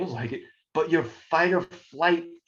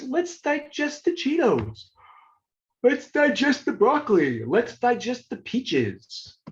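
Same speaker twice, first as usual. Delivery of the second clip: higher, cheery talking-stomach voice; 10 semitones higher than usual. Pretend. Teacher voicing the stomach for the class, higher cartoon voice. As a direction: give the stomach a bright cartoon voice.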